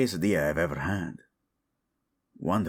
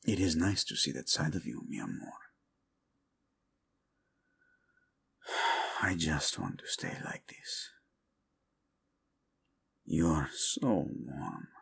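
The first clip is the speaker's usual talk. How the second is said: much softer, slowing to a murmur; tender and intimate; 3 semitones lower, 5 dB quieter than usual. Acted. Husband holding his wife, soft tender murmur. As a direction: melt into it, close and content.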